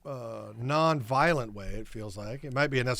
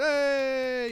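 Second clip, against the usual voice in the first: drawn-out, high mock cheer; crowd cheer; 11 semitones higher than usual. Crew congratulating a wrapped actor, imitated cheer. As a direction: stretch it up like an applauding crew.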